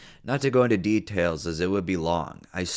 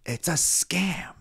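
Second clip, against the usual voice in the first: high, slow, strained mock pleading; retold exasperated plea; 9 semitones higher, slower than usual. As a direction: draw it out to calm grandma down. Retells pleading with panicked grandma.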